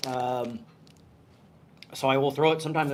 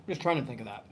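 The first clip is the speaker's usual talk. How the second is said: mouth on fire; 3 semitones lower, 7 dB quieter than usual. Real, not acted. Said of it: "my mouth is on fire".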